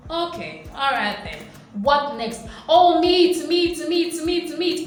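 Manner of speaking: mock the crowd's nagging demand; bored then greedy. Israelites whining to God for meat, mock greedy chant. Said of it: "they're like".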